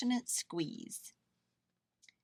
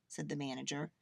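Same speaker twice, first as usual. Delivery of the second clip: ordinary delivery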